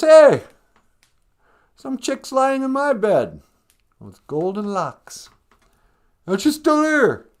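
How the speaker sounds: high, loud cub raising the alarm; slow, high-pitched tattling little bear; outraged Baby Bear. Acted. Baby Bear finds the intruder.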